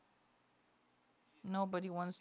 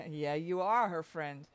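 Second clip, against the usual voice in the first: ordinary talk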